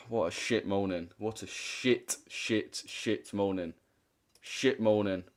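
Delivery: soft, defeated grumble; deflated mutter. Real, not acted. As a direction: sink into it quietly. Dejected after the rant.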